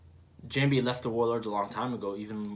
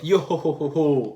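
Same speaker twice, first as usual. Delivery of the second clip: loud, higher-pitched, hearty character laugh; Brook; 3 semitones higher, 8 dB louder than usual. Acted. Reader voicing Brook's greeting, loud hearty laugh. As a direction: belt out a rolling yo-ho laugh. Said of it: "So, Brooke".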